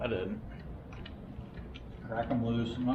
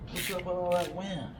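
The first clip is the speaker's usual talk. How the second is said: higher, softer teasing pet voice; 7 semitones higher, 3 dB quieter than usual. Real, not acted. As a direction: tease the dog sweetly. Teasing the dog again.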